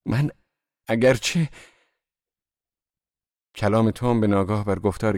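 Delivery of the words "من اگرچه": halting, effortful start; visible effort. A narrator's reading. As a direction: struggle to get each word out. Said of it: "با تلاشی بسیار مشهود گفت". (با تلاش آشکار و بریده)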